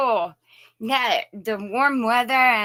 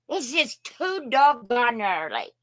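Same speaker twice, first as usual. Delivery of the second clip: ordinary talk, nothing marked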